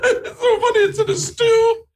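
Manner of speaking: squealing through laughter; high-pitched laughing fit; laughing hard. Real, not acted. Host cracking up at dry halibut.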